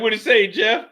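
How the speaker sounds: high, loud question; eager question. Retold or imitated. Narrator asking Jeff about his diagnosis, loud eager question. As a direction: ask him eagerly.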